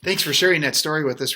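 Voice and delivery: ordinary delivery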